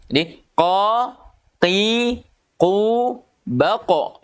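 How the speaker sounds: qa, qi, qu said slowly with the tongue back and lips still; teacher drilling qa qi qu; qaf syllables drill. Shown as a demonstration. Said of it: "pangkal lidahnya harus diangkat".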